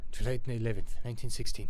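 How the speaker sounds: put-on loud, amateur French accent. Player reading the soldier's letter date, French accent. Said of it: "I gotta do a French accent".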